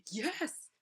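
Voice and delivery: high, loud cry of pain; pained yelp. Retold or imitated. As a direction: blurt it as if poked where it hurts. Retold yelp when poked where hurt.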